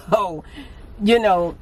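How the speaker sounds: normal talking voice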